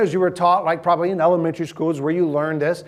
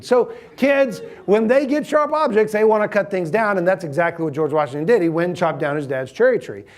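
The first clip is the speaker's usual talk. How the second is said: usual speaking voice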